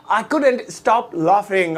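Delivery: very slow, word by word; slow model repeat. Shown as a demonstration. Teacher repeats the English translation for learners, slow and drawn out. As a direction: stretch out each word, especially "laughing।".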